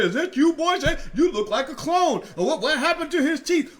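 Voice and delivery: high, loud, laughing mock heckle; impersonating mocking viewers, laughing; mocking commenters. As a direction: ham up the trolls making fun of his teeth. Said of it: "just making fun of me".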